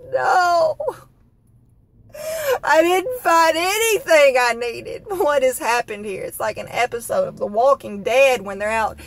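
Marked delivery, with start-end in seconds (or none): 0.1-5.0 high, slow whimpering wail; hammed-up blubbering answer; mock crying. Telling the cashier she found nothing, mock sobbing.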